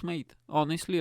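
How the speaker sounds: usual speaking voice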